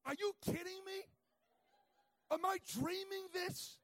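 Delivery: high, loud incredulous outcry; mock disbelief. Preacher retelling his shock at the counter, loud mock disbelief. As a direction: play up the shock of it. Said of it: "And I'm thinking".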